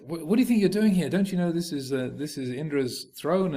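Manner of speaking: how dare this imp sit there; rising indignation, rushed; indignant outrage. Acted. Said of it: "get very upset".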